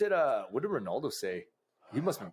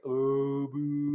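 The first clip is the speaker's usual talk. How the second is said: long, drawn-out mock boo; booing crowd. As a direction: imitate a stadium crowd booing. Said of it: "the crowd's like".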